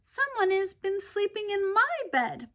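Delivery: put-on Baby Bear squeaking about his bed; tiny little squeak. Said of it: "Baby Bear squeaked in his tiny little voice".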